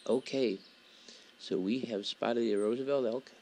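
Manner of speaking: normal talking voice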